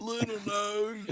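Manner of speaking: high, drawn-out teasing call; teasing name-call. Acted. Wes taunting the actress about her nose, high teasing call. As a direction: stretch it out high to needle her.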